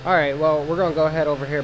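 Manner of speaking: ordinary talk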